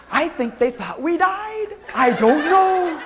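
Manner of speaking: ordinary delivery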